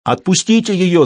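high yell: «Отпустите её!» shouted. Acted. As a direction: yell out the demand. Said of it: "завопил он".

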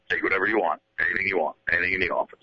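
low, muttering manager check-in; Jim Leyland impression. Leyland quizzing the host in his office, low grumble. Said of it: "a little more of that".

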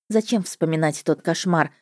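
ordinary delivery

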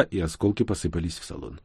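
ordinary delivery

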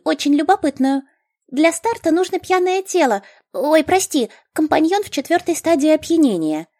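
high, eager gnome voice; bubbly gnome explaining. A narrator's reading.

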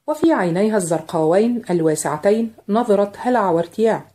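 plain reading voice

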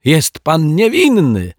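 an overjoyed, high, drawn-out declaration; delighted announcement. Acted. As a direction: proudly declare the good news. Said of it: "oświadczył uradowany detektyw Pozytywka".

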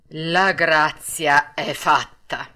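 slow, measured pronouncement; puppet master's answer. A narrator's reading. Puppet master grants Arlecchino his pardon, slow and solemn. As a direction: grant it slowly, weighing each word. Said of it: "rispose Mangiafoco".

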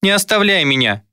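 put-on high, pleading boy's voice; high-pitched tearful plea; tearful child's babble. A narrator's reading. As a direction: beg like a frightened little child. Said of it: "пролепетал я, заливаясь слезами".

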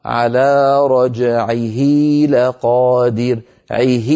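slow chanted tajweed recitation; Quran recitation. Shown as a demonstration. Teacher reciting the verse to students: slow Quranic chant. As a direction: recite it, drawing out each vowel. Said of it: "لو تابعنا التلاوة".

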